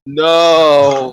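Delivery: loud, drawn-out "no"; dismayed protest. Real, not acted. Player begging a friend not to taste the jug, loud dismayed protest. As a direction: wail a long no in protest.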